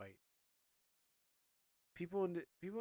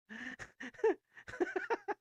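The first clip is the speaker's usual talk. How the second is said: high laugh; 6 dB louder than usual. Real, not acted. Laugh after character line.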